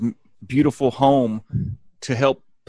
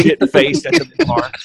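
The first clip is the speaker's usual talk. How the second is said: much higher, slower put-on voice; imitating drunk Chelsea Clinton; 12 semitones higher, 5 dB louder than usual. Chelsea Clinton drunk at the bar, high mock voice. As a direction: drawl it high like a tipsy girl.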